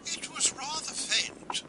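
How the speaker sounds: high, soft regal disapproval, taken slowly; Queen Victoria. Retold or imitated. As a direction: sniff it out as the unimpressed old queen. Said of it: "Victoria's verdict?".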